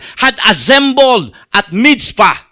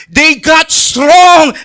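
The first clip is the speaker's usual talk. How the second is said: slow and louder, "strong" stretched and punched; emphatic build; 4 semitones higher, 4 dB louder, much slower than usual. Acted. Preacher telling how the Israelites grew bold, emphatic. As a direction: land the word like a victory.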